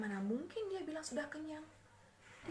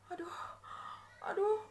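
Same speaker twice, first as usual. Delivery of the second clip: rising wails of pain, higher and louder each time; crying out in pain; 9 semitones higher, 6 dB louder, much slower than usual.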